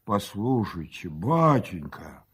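low, slow, booming-man register; surprised bass. A narrator's reading. Mukhanov spreading his hands at the husband, surprised bass. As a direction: drop deep and stretch the address. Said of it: "удивлённо пробасил".

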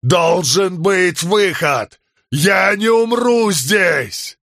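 high, stretched-out shouting; desperate yelling. A narrator's reading. Man refuses to die here, desperate yelling. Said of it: "заорал Халин".

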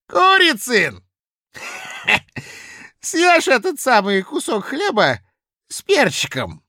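put-on loud, high drunken bluster; drunken blustering official. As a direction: boast it loud like a tipsy clerk. Drunk Kozulin orders.

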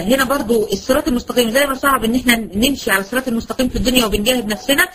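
ordinary talk, nothing marked

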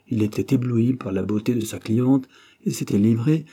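usual speaking voice

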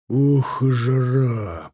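drawn-out puff at the heat; mild weary muttering. A narrator's reading. Cossack wiping his sabre under July sun, muttering. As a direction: complain to yourself about the heat. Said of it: "пробормотал он".

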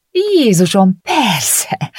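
mildly hammed-up outburst; snapping out. A narrator's reading. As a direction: blurt it out, exasperated. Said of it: "csattant fel Gabi".

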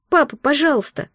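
put-on high, tearful child's plea; crying plea. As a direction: beg through tears. Crying child begs father.

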